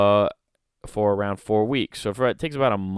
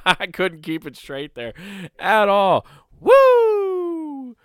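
laughing; 11 semitones higher, 8 dB louder, much slower than usual. Real, not acted. Laughing at own bit.

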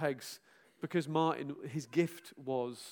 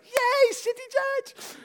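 very high, slow drawn-out "Yay"; gleeful cheer; 8 dB louder, much slower than usual. Retold or imitated. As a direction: squeal it with joy at coming back. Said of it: "I was like".

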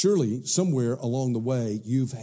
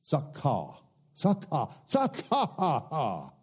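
mocking laugh sound; 3 semitones higher, slower than usual. Shown as a demonstration. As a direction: laugh it out like mockery. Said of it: "It sounds like what it is".

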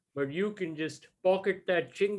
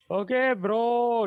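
drawn-out bro; 8 semitones higher, 5 dB louder, much slower than usual. Real, not acted. Calming a stressed friend.

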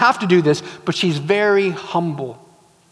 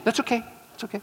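very soft, higher aside; hushed dismissal; 4 semitones higher, 11 dB quieter, much faster than usual. Acted. Wife tells genie she accepts the catch, hushed aside. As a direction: play the wife quietly brushing it off.

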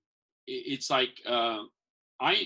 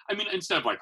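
ordinary talk

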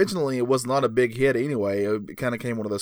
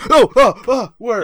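startled awake; 9 semitones higher, 10 dB louder than usual. Acted. Johnny jolted awake.

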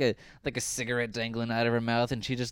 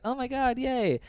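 much higher, hammed-up squeal of joy; mock delight; 12 semitones higher than usual. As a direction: gush like a fake ad family. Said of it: "And they're like".

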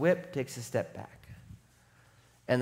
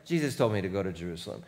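calm Paul voice; 10 semitones lower, 7 dB quieter, much faster than usual. Retold or imitated. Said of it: "Paul's like".

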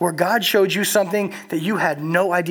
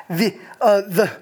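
halting mock stage fright; nervous stammer; 3 semitones higher, slower than usual. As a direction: stumble over the words. Nervous speech stammer reenacted.

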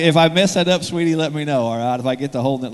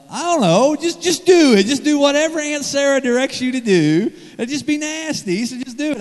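flustered high voice; 11 semitones higher than usual. Retold or imitated. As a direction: blurt it out high and helpless like a cornered uncle. Flustered answer to niece's question.

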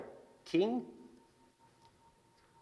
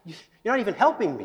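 complaining to God; 7 semitones higher, faster than usual. Retold or imitated.

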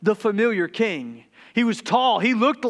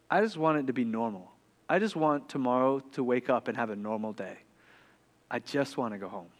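very soft, low, sad confession; subdued, small plea to a parent; retold homesick child; 9 semitones lower, 8 dB quieter than usual. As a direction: quietly voice a heartsick child, with long pauses. Retells sad daughter's homesick confession.

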